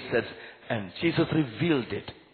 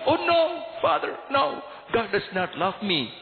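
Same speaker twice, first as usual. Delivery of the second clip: crying out; 10 semitones higher than usual. Retold or imitated. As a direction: burst out in protest. Boy cries out in denial.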